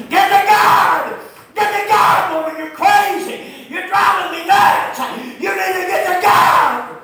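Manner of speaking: high, full-volume frantic scolding; screaming at her. Retold or imitated. Husband hounding his unsaved wife, screaming at her. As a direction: scream it out, stretching the words. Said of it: "I'm sitting over there screaming at her".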